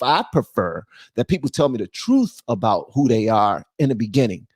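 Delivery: ordinary delivery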